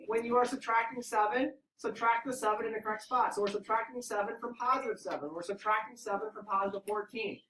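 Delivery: usual speaking voice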